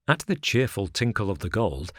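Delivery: plain reading voice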